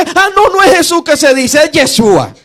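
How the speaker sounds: high-pitched mock realisation; high, excited self-correction in mimicry; confused student. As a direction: act out a learner correcting himself, pitched well up. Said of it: "entendió que".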